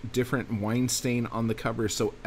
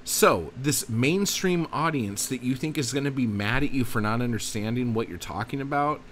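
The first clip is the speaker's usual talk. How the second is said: ordinary talk, nothing marked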